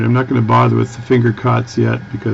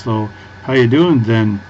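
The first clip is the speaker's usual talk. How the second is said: put-on light Minnesota accent, slower, with "then" tacked on the end; Fargo accent with "then". Quoting Fargo characters who add "then", light Minnesota accent, slower. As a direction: ease "then" onto the end like a Fargo local. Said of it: "they say words like "then" on the end".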